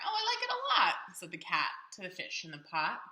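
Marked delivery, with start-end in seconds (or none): 0.0-1.2 delighted cat. Acted. Cat answers cheerfully.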